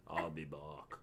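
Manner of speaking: lightly voiced action-hero catchphrase, low and soft; Arnold Schwarzenegger. Co-host demonstrating Arnie on request, low Arnie impression. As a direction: drop it low and quiet in an Arnie accent. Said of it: "Arnold Schwarzenegger".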